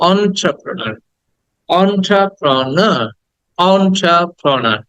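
slow "এন্টারপ্রেনার" said as "on-tra-pra-na"; open "o" start. Shown as a demonstration. Teacher drills students, slow "এন্টারপ্রেনার" with "অ" onset. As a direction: break "এন্টারপ্রেনার" into syllables, starting "awn". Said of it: "অ".

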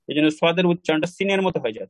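ordinary talk

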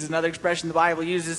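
usual speaking voice

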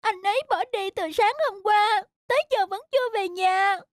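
high kitten voice; little kitten. Acted. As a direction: explain anxiously like a small kitten. Kitten Mít explains.

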